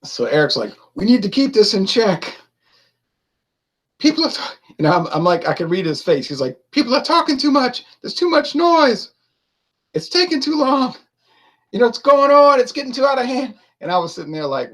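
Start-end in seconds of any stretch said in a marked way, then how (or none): 1.0-4.6 high, exasperated imitation; Eric getting irritated. Host trying to keep panel in check, mock irritated. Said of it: "he was getting so irritated". 6.7-13.5 very high, worked-up imitation; mock exasperation, pitched far up; Eric getting irritated. Host complaining about a noisy panel, mock irritated. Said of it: "he was getting so irritated".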